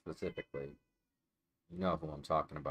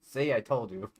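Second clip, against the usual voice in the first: sudden loud told-you; 5 semitones higher, 7 dB louder than usual. Real, not acted. Cat attacks as predicted.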